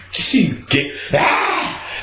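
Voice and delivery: ordinary talk, nothing marked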